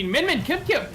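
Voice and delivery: name yelled high and loud; excited shout. Real, not acted. Caster hyping a player's big play, excited shout. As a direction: call out the player's name as the kill lands.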